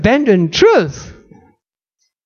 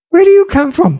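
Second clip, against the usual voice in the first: startled question; 9 semitones higher, faster than usual. Acted. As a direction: press him hard for an answer. Pilate questions Jesus.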